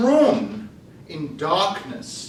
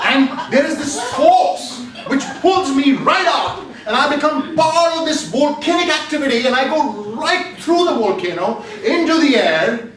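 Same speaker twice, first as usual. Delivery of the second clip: rising excitement; 7 semitones higher, 4 dB louder than usual. Acted. Shot up through volcano.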